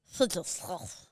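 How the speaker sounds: slow lisping Sid the Sloth, S's lost. Retold or imitated. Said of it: "He lost the S's".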